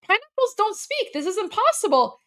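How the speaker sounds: put-on high, insistent child voice; lightly voiced child insisting; puzzled kid. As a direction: protest like a little kid. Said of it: "the kid is like".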